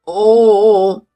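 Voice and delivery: loud drawn-out "ooh" of mock alarm; hammed-up flinching wail; long, loud, overdone recoil noise; exaggerated cowering cry. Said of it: "하면서 오바해서는 몸을 움츠리고".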